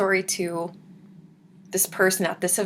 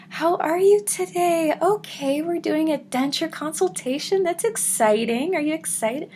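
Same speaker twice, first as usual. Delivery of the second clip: high, bubbly upbeat greeting; lively hygienist; 9 semitones higher than usual. Retold or imitated. Dental hygienist greeting a nervous patient, bubbly and bright. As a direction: chirp it like a cheerful receptionist. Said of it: "Lively as could be".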